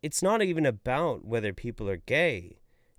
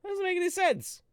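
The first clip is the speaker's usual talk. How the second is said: high squeaky voice. Pretend.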